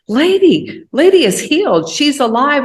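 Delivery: high and loud, overjoyed; joyful announcement. Retold or imitated. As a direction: cry out the miracle. Friend announces horse is healed.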